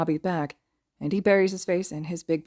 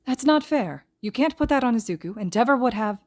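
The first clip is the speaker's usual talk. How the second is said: protesting; 7 semitones higher, 3 dB louder than usual. A narrator's reading. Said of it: "Momo whirls to stand".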